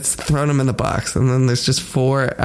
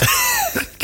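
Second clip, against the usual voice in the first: high shrieking laugh. Real, not acted. Laughing at own joke.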